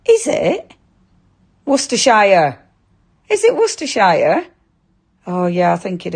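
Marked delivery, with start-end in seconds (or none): 1.6-2.6 louder "Worcestershire" as WUSS-tuh-shy-er; trying out Worcestershire. Real, not acted. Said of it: "have I said it right again". 3.6-4.5 high, questioning "Worcestershire" as WUSS-tuh-shy-er; checking Worcestershire. Real, not acted. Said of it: "have I said it right again".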